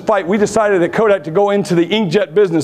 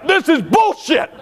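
imitating an outraged driver, slower at the end; mock outrage; 6 semitones higher, slower than usual. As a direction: play the fuming driver at the pump. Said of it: "you're freaking outraged".

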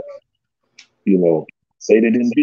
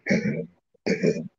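whispered curse; 8 semitones lower, 9 dB quieter than usual. Retold or imitated. Retelling amazement at the fight.

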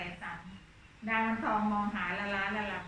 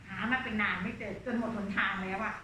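usual speaking voice

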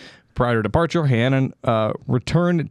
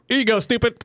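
much higher, sneering handover; mocking handover; 11 semitones higher, faster than usual. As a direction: hand him over with mock contempt. CIA hands spy to FBI.

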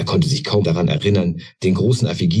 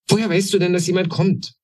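much higher, amazed little goblin; astonished; 13 semitones higher than usual. A narrator's reading. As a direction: ask in wonder. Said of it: "fragte Krakel erstaunt". (sehr hoch und erstaunt)

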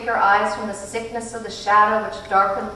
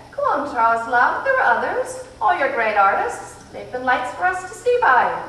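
higher, louder cheerful urging; eager encouragement; 5 semitones higher, 5 dB louder than usual. A narrator's reading. Missus Watson urging Charles on, bright and eager. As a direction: egg the boy on brightly.

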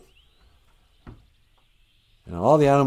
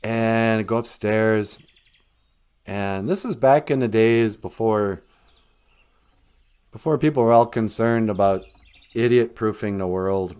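ordinary talk

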